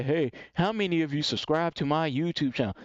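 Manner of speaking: put-on tentative audience question; mock a streamer weakly fishing for subscribers; passive asking. Shown as a demonstration. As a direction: ask meekly, in an affected voice. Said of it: "that don't get you to wanna take no action".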